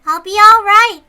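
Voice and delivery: put-on high, loud and chirpy child; cute little girl. Little Rika cheerfully reassuring her worried friends. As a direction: chirp it like a carefree kid.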